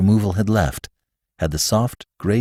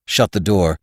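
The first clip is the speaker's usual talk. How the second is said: ordinary delivery, nothing marked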